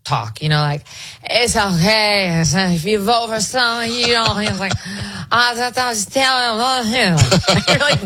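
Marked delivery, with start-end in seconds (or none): 1.2-7.2 mock drunk politician. Commentator mocking a politician's phony speech, drunk slurred drawl. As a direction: slur the words into drunken, higher-pitched mush.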